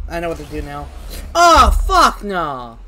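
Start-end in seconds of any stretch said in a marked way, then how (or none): 1.3-2.8 loud, drawn-out cry of alarm; alarmed shout. Real, not acted. Player reacts to the monster he hates, alarmed shout. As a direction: cry out slowly and loudly in dismay.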